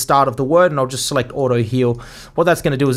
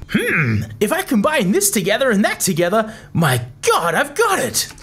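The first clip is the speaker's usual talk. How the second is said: podcast preset voice; 8 semitones higher than usual. Acted. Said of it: "let's have a listen to what that sounds like".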